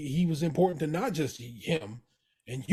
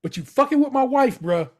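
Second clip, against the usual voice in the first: raised, higher voice getting louder at the end; emphatic Pete; 9 semitones higher, 6 dB louder than usual. Retold or imitated. Pete tells Carmy off firmly, raised voice. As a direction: Pete pressing the point hard, building to a near shout.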